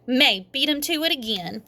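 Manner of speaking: usual speaking voice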